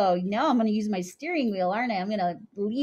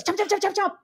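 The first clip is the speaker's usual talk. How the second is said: a quick, higher run of "Chomp, chomp, chomp, chomp"; noisy fast chomps; 8 semitones higher, faster than usual. Shown as a demonstration. Teacher shows the noisy alligator that scares lunch, fast chomps. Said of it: "he's gonna scare away his lunch".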